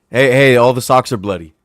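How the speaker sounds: ordinary talk, nothing marked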